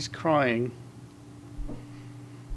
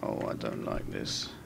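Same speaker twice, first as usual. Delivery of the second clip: scared whisper; 10 dB quieter than usual. Real, not acted. Scared in horror game.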